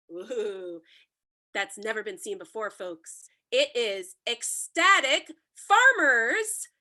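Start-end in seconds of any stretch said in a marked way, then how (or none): 3.5-6.7 grand announcement. Acted. Host announces movie title grandly.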